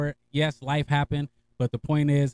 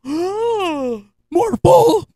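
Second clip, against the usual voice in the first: much higher, louder, stunned echo; shocked disbelief; 7 dB louder than usual. Retold or imitated. Echoing his homie's remark in high, loud shock. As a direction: throw the words back in disbelief.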